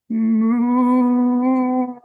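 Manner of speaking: mooing like a cow; storyteller's moo. Acted. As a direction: give a drawn-out moo.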